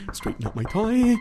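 ordinary talk, nothing marked

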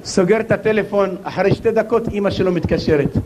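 ordinary delivery

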